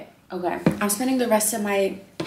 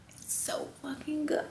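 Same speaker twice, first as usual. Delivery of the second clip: higher, hushed, guilty whisper; whispered craving; 7 semitones higher, 10 dB quieter than usual. Retold or imitated. Confessing her weed craving to viewers, guilty whisper. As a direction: whisper it like a secret pleasure.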